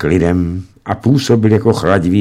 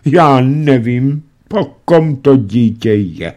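slow, raised lamenting voice; 5 semitones higher than usual. A narrator's reading. Mother lamenting over her son, slow raised voice. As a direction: despair over the child. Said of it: "bědovala matka".

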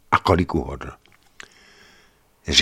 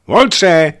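high, loud shout up the stairs; wife calling her lover from below; 11 semitones higher, 9 dB louder than usual. Retold or imitated. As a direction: yell the lover's name to come down. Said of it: "A potom zavolala".

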